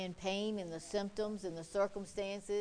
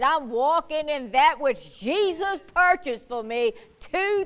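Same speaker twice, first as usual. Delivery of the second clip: loud, high, full-force preacher's shout; impassioned shouted declaration of faith; forceful preaching rebuke; 5 semitones higher, 13 dB louder than usual. Retold or imitated. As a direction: drive the devil out at the top of your voice. Preacher acts out rebuking the devil.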